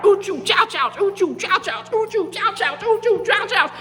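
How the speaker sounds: very high, rhythmic gator yelping; mock pain yelps. Gators slithering through the fire, high rhythmic cries of ouch. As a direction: yelp in a bouncing high chant.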